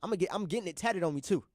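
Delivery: usual speaking voice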